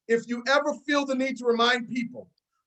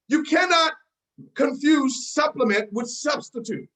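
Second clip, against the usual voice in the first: ordinary talk, nothing marked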